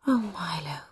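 soft, whispered tenderness; murmured. A narrator's reading. Mother murmuring as her son's cheek meets her hand. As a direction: breathe his name lovingly. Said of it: "she murmured".

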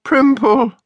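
high, tearful call; weeping. A narrator's reading. Gemma watches her dog carried off, calling tearfully. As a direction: call the name high, as if in tears. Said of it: "wept Gemma".

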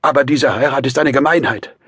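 high, loud, desperate insistence; strained, effortful outburst; mustering last strength. A narrator's reading. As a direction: force out an ultimatum with all you have left. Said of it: "rief Raskolnikow unter Aufbietung seiner letzten Kräfte".

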